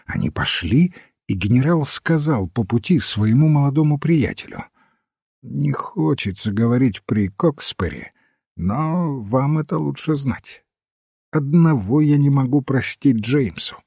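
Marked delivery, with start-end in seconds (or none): none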